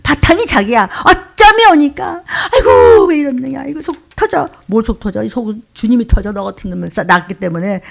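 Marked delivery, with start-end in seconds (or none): 1.0-4.5 high, loud mock lament; self-centred wailing. Believer crying over their own troubles, self-centred wailing. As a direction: moan in hammed-up exasperation. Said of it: "자기로 인해서 운다는 거예요".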